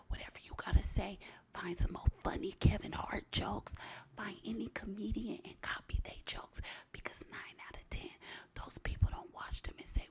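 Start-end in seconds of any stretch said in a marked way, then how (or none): none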